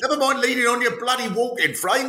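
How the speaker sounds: high-pitched impatient mock yelling; hectoring. Relative goading old fundraiser to keep walking, hectoring mock yell. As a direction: chivvy them along in an exasperated high voice. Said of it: "you could have someone going".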